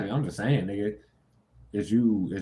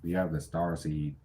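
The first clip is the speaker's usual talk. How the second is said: ordinary talk, nothing marked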